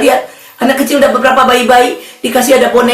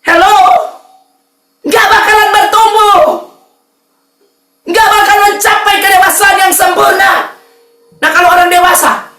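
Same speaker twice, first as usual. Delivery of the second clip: raised, driving sermon delivery; fiery preaching; 5 semitones higher than usual. Acted. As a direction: preach it hard to the congregation. Preaching at full force. (berkhotbah dengan semangat berapi-api)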